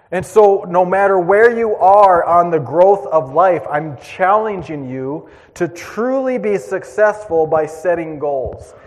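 slow, emphatic pulpit call; preaching. Acted. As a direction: urge the congregation to set goals. Preaching exhortation.

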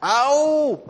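a sudden high, loud yelp of pain; mock ouch. Preacher answering his own call for ouch, loud mock yelp. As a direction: yelp as if your toe got stepped on. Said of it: "somebody say ouch for me".

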